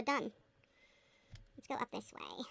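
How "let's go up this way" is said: soft whisper to yourself. Real, not acted. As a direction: whisper it while concentrating. Whispering to self in game.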